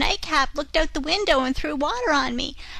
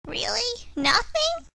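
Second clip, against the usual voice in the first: disbelieving; slower than usual. A narrator's reading. Repeated in disbelief.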